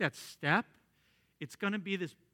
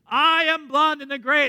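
booming showman announcement, much higher and louder; grand ringmaster boast; Blondin the showman; 10 semitones higher, 11 dB louder than usual. Retold or imitated. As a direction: proclaim yourself like a circus star. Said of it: "And he says".

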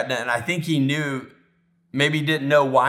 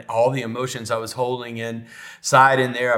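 ordinary delivery

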